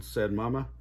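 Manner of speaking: ordinary delivery, nothing marked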